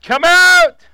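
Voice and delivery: high, booming shout with a pause between name and command; loud commanding call. Acted. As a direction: call out as if to someone inside a tomb. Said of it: "called in a loud voice".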